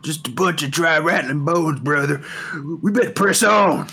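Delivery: high, loud character voice; gruff barbarian voice. Acted. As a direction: boom it out like a brash brawler.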